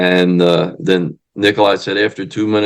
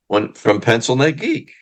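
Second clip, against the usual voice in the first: normal talking voice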